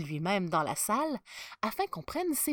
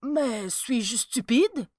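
slow, higher-pitched aghast question; worried self-doubt; 4 semitones higher, 5 dB louder, much slower than usual. A narrator's reading. Archbishop asking himself if he is stupid, worried. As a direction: draw it out in dismayed self-questioning.

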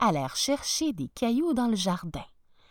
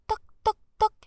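knocking sound; 13 semitones higher, 5 dB louder, much faster than usual. Acted. Girl knocks on door.

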